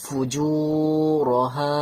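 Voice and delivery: ফুজু with long u, রহা with long final a; slow recitation, holding জু and হা; elongated vowels. Shown as a demonstration. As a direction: stretch জু and হা, keep র short. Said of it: "তাই জন্য টান দিচ্ছি".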